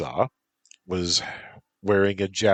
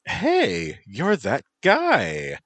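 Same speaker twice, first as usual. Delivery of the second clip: put-on high, drawn-out valley girl call; bubbly valley girl calling out; 13 semitones higher, 5 dB louder, much slower than usual. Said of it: "said the Valley Girl voice".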